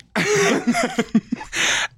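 squealing high laugh; high shrieking laugh. Real, not acted. Storyteller shrieking with laughter at his own punchline.

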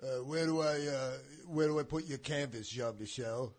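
a low, lightly voiced impression. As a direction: drop the voice for a mild impression. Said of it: "your impression's better than mine".

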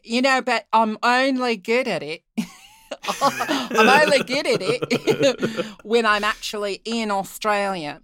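amused, drawn-out Australian imitation; Australian accent. Shown as a demonstration. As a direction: play at being Australian, laughing partway. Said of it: "doing my Australian accent for you".

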